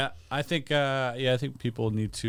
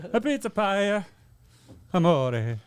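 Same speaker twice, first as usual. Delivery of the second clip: mock Italian accent; 8 semitones higher, much slower than usual.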